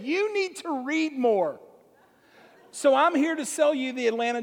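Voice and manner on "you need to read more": slow, higher, pushy delivery; pushy salesman. Retold or imitated. Paper boy jabbing the homeowner, slow and pushy. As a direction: lean on each word like a poke in the chest. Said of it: "and said".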